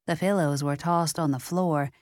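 ordinary delivery

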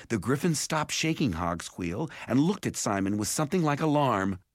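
usual speaking voice

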